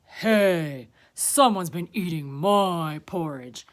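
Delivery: big, loud bear voice; Papa Bear. A narrator's reading. Papa Bear finding his porridge eaten, loud indignant voice. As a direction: boom it out, indignant. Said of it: "Papa Bear looked at his porridge".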